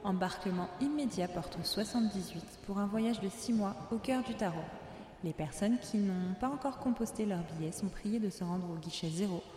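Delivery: soft, even airport announcer voice over music; station announcement. Acted. Host playing the gate announcer to her listeners, calm announcement voice. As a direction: call the last boarding like a terminal loudspeaker.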